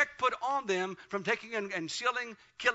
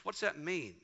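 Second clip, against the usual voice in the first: ordinary delivery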